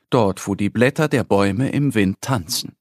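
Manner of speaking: ordinary delivery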